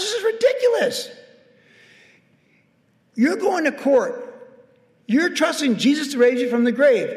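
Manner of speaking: high, forceful preaching; exasperated preaching. Acted. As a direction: hammer it home, fed up with their fear. Pastor rebuking worry, preaching hard.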